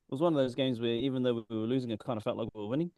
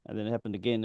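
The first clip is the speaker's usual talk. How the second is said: normal talking voice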